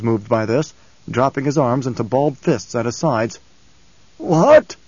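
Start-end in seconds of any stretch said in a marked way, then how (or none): 4.2-4.7 loud, high, grating outburst; an angry adviser grating at the old man; grated. Acted. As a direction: bite the word out in disbelief. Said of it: "he grated".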